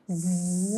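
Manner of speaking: ز buzzed quietly through the teeth; hissing z. Shown as a demonstration. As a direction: press ز out with firm teeth. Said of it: "پہلا حرف ہم سختی کا کیا سیکھیں گے".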